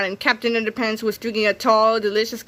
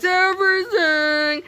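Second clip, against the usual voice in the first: slow dramatic everything, higher; drawn-out gloat; 8 semitones higher, much slower than usual. Acted. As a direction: stretch it out like a villain. Villain gloats dramatic list.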